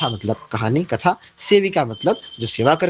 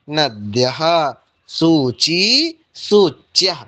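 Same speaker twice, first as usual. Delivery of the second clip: slow, deliberate model reading of each word; careful clear pronunciation; much slower than usual. Shown as a demonstration. Teacher repeating Sanskrit plurals slowly for students. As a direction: lay out every ending so students can copy it. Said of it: "मैं इनका पुनः उच्चारण कर देता हूँ".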